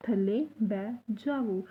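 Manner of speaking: slow, clear थल्ले बै जावो; teacher dictation pace; slow model sentence. Shown as a demonstration. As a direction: say it slowly twice for learners. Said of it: "तो पूरा sentence कैसे बोलेंगे".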